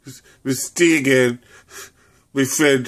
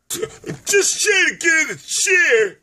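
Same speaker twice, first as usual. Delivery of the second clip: put-on high old lady voice; 13 semitones higher, slower than usual.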